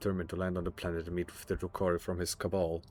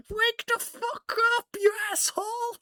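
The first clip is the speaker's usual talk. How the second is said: mock yell. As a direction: scream it like a raider on the battlefield. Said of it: "all you're just doing is like".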